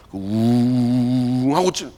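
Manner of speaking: slow, low droning hum; low rumble imitation. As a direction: drag out the rumbling sound.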